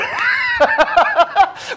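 a burst of high, loud laughter; big real laugh. Real, not acted. Preacher laughs at repeated question.

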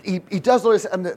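usual speaking voice

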